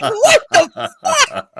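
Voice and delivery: slow, high-pitched incredulous cry; shocked outburst. Retold or imitated. Staring at the phone after lewd call, aghast. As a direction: stretch it out in high disbelief.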